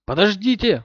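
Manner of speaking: high desperate yell; desperate shout. Acted. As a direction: cry out in despair. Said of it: "в отчаянии заорал он".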